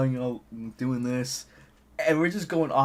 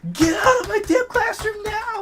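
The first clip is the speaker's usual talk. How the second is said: very high, loud mock screech; imitated furious scream; 5 dB louder than usual. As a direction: screech like a teacher losing it. Teacher's order escalates to screaming.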